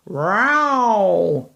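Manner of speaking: long yowling cat cry. Acted. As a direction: wail like a cat. Cat yowl sound in story.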